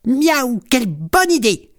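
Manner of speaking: very high, excited kitten voice; gleeful high-pitched meowing cheer; delighted exclamation. A narrator's reading. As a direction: exclaim with glee, far above the narration. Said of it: "s'exclament en chœur les deux autres chatons".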